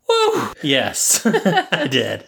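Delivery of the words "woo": a sudden high-pitched woo; high excited woo. Retold or imitated. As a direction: squeal it out, very high. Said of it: "did I at some point go".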